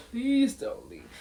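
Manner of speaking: hammed-up begging wail; mock sobbing plea. Wife begging her husband at the door, mock sobbing plea. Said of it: "That girl is sobbing".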